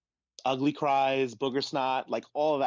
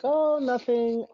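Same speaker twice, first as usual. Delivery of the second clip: high, drawn-out innocent "oh, nothing"; friend covering up; 13 semitones higher, 4 dB louder, slower than usual. Retold or imitated. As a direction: play it down slowly in a much higher voice. Friend hiding tears, voiced.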